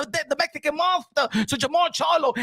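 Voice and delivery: normal talking voice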